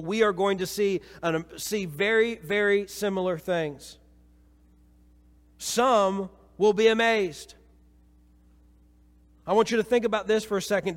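full-force sermon to the congregation; fervent preaching. Acted. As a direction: drive the message home with fire. Sermon climax, sustained raised voice.

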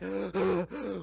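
a loud gasp for air after running; winded gasp. Retold or imitated. Runners arriving from Emmaus, loud winded gasp. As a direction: gasp like you've run seven miles. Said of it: "trying to catch their breath".

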